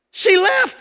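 high, loud wail; mock distraught cry. Husband suddenly finding his wife gone, mock distraught cry. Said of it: "all of a sudden it's like".

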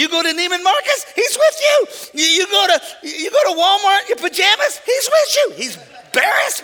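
high, loud, rhythmic refrain; preaching at full force. Real, not acted. Preacher telling the crowd God goes everywhere, shouting. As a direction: hammer the refrain each time.

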